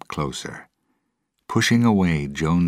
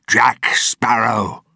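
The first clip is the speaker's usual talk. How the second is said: slow, drawn-out shout, much higher and louder; furious shout; 8 semitones higher, 6 dB louder, slower than usual. Acted. Captain realising who stole his key, slowly bellowing the name. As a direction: bellow the name, each word stretched. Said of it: "he shouted".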